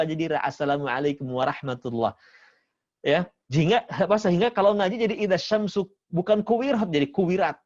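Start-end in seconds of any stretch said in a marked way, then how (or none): 4.2-7.6 Sumatran reading. Retold or imitated. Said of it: "huruf-huruf yang pakai O suka jadi A".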